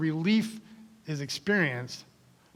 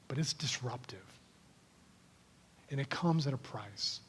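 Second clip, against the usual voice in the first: hushed prayer; 4 semitones lower, 10 dB quieter than usual. Acted. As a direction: pray quietly over the congregation.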